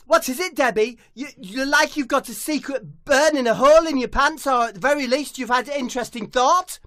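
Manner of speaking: high, loud questioning; high loud comic question; high footballer voice. Acted.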